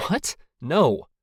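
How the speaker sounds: startled, emphatic "No"; shocked refusal. A narrator's reading. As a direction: refuse at once, taken aback. Refusing a stranger's request.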